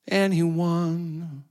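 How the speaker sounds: ordinary delivery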